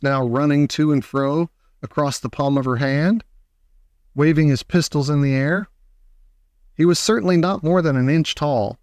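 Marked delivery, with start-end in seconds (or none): none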